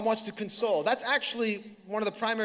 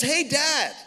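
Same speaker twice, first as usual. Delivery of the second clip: higher little-daughter call; imitated daughter; 6 semitones higher, 4 dB louder than usual. Daughter pops out to greet Dad, imitated daughter voice. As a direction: mimic a daughter calling her dad. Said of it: "my daughter".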